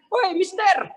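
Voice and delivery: high, loud hail across the street; mock shout for a passerby; calling out. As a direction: flag down a stranger. Calling out to an Italian stranger.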